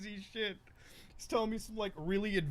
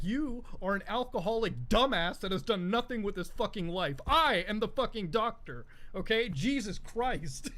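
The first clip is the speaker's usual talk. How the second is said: imitating furious dad; 3 semitones higher, 4 dB louder than usual. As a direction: put him in his place like a proud doctor. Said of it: "my dad's like".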